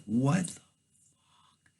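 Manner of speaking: slow, stunned whisper; whispered disbelief. Retold or imitated. Rider hearing his horn stick on, stunned whisper. Horn stuck, hears beep.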